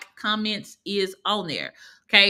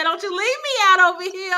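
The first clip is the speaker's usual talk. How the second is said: high, louder mock complaint; playful mock protest; 10 semitones higher, 5 dB louder than usual. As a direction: voice a teasing plea, pitched way up. Voicing a viewer's playful complaint.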